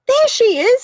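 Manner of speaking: put-on high, loud girl's cry; high excited child voice; excited little girl. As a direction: spot Lucy and call out. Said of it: "said Rose".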